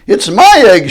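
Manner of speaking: high, loud angry splutter. Acted. Maisie fighting Horton for the egg, loud angry splutter. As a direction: sputter it out in a rage. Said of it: "she spluttered".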